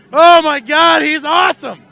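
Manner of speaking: loud, high cry of delight; announcer cheering a wild driver; thrilled shout. Real, not acted. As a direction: shout your joy at the driver's move.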